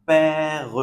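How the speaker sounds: "père" held long on the open "è", final "re" sounded apart; open è demo. Shown as a demonstration. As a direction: stretch "père" into pè-re. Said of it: "on prononce est". (père découpé, è ouvert)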